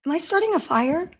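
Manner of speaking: ordinary talk, nothing marked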